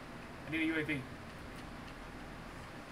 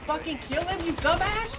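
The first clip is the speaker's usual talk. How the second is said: exasperated scolding; 3 semitones higher, 4 dB quieter than usual. Real, not acted. Player urges teammate to kill nearby enemy, exasperated scolding. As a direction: berate a useless teammate, rising in pitch.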